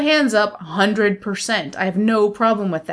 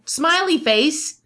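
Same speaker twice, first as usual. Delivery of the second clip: slow, higher-pitched sweetness; pointedly nice; 6 semitones higher, much slower than usual. Retold or imitated. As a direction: draw it out high, playing nice. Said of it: "like, in a nice way".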